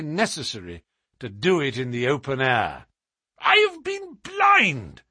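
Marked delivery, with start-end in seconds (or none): 3.4-5.0 high, loud, drawn-out cry of realization; slow, high exclamation, struck by sudden insight; exclaiming cry. A narrator's reading. Colonel suddenly grasps the explanation, crying out high and loud. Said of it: "cried the Colonel".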